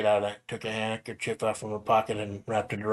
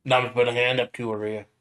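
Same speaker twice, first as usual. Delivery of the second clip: ordinary talk, nothing marked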